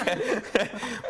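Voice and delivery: high burst of laughter; real high laughter. Real, not acted. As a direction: laugh out loud at the memory. Laugh after imitation.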